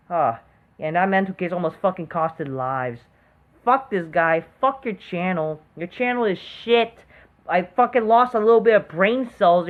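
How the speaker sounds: normal talking voice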